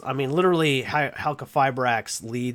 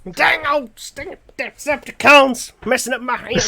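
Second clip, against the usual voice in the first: put-on thick angry Southern accent, high and loud; Southern-accented mock rage; Boomhauer; 11 semitones higher, 5 dB louder than usual. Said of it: "turn into borderline Boomhauer from Hank of the Hill".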